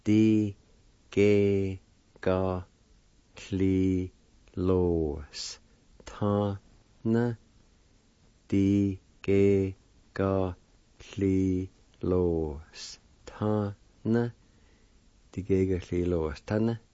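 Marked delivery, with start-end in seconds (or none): 0.0-7.4 slow, held syllables with a pause after each. Shown as a demonstration. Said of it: "it spells like this". 8.5-14.3 slow, stretched syllables with gaps. Shown as a demonstration. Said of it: "it spells like this".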